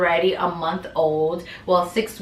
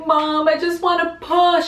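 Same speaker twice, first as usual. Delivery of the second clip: hysterical crying; 9 semitones higher than usual. Retold or imitated. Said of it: "I'm, like, hysterically crying".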